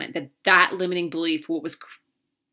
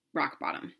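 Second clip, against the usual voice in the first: hushed confession; 3 semitones lower, 8 dB quieter than usual. Real, not acted. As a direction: drop to a near whisper on "rock bottom". Telling of her lowest point.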